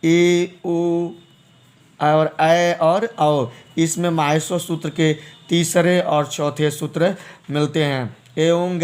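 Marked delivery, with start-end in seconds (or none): 0.0-3.5 ए, ओ, ऐ, औ stretched very slowly; drawn-out vowel demo. Shown as a demonstration. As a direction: hold each vowel long for students. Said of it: "drawn out vowel demo".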